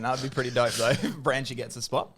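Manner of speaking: usual speaking voice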